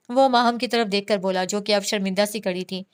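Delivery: ordinary delivery, nothing marked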